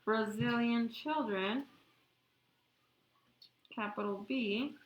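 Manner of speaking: very slow dictation, one word at a time; dictating. Real, not acted. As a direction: spell it out slowly for note-takers. I hope you get this all down before I erase.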